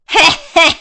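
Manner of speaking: high wicked cackle; evil witch cackle after her threat. Acted.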